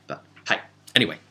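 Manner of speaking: ordinary delivery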